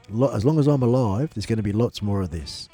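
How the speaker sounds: usual speaking voice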